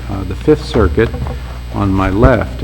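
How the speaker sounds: ordinary talk, nothing marked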